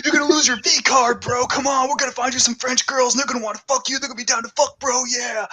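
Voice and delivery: high, mock frat bro voice. Friend mocking the hero, pushy bro voice.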